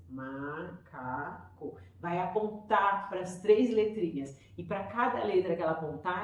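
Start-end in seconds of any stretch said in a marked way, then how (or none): 0.1-1.8 macaco read out very slowly, one syllable at a time; slow syllabic reading by a child. Shown as a demonstration. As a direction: stretch macaco into ma-ca-co like a child sounding it out. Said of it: "Ela vai fazer assim".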